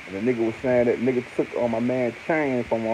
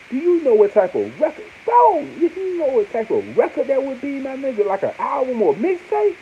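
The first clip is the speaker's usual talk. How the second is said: pitched way up, thrilled and fast-talking; excited high; 14 semitones higher, 4 dB louder than usual. Real, not acted. Hyped fan dreaming of an album, excited high. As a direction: get giddy imagining the record.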